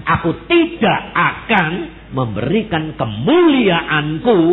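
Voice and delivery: loud, slow, word-by-word proclamation; solemn divine declaration. Acted. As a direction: proclaim it as God, each word weighted. Voices God's firm declaration.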